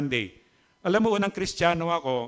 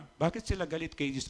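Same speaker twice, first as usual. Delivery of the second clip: the same question again, much softer; a quieter echo of the question; softer repeat; 9 dB quieter than usual. Acted. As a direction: drop the volume and ask again.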